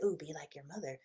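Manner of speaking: soft, intrigued little "ooh"; a curious inner voice noticing something shiny; hushed and intrigued; perked-up subconscious. Pretend. Subconscious mind perks up.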